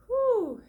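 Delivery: a sharp, loud, high exhale of pain; real whew at the ache. Real, not acted. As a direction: blow out the tension with a loud whew. Feeling the intense neck pain.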